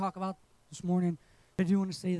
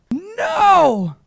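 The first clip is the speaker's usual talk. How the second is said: high, loud yelled "No!"; sudden shout; 9 semitones higher, 11 dB louder than usual. Retold or imitated. Cousin yelling at a sprinting pit bull, loud shout. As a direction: yell it to freeze the dog. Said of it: "he does it again".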